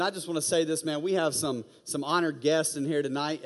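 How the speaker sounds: ordinary talk, nothing marked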